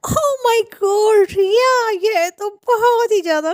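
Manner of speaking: high, drawn-out "oh my God"; mock amazement. Friend teasing Riya about her sister-in-law, mock amazed. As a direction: gush in high, exaggerated disbelief.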